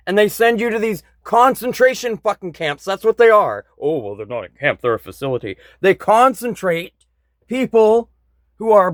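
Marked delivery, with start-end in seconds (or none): 3.8-5.6 quick, quieter sarcastic aside; dry mocking voice correcting the word camp; sarcastic mock correction. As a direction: toss it off like a smug official, under your breath.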